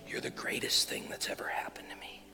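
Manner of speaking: whisper. Shown as a demonstration. Whispering to Katie closer.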